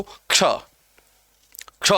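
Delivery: क्ष as k plus sh, no vowel before it; correct ksha. Shown as a demonstration. Teacher models the letter after correcting it, clean ksha क्ष. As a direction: start क्ष straight on the k. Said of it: "ये उच्चारण करना है".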